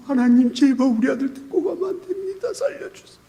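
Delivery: high, broken prayer fading to a breath; tearful plea. Retold or imitated. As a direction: beg God for your son's life, alone and weeping. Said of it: "화장실 가서 혼자 울었어요".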